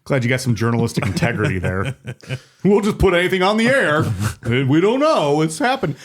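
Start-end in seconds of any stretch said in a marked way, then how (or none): 2.6-6.0 mocking news anchor. Co-host mocking the network's journalistic integrity, high mock voice. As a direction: toss it off as a careless broadcaster, pitched up.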